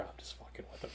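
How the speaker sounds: retold whispered aside; confidential whisper. Actor to his friend about the prank, whispered. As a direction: lean in and let her in on it, whispering. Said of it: "he leaned over and told her".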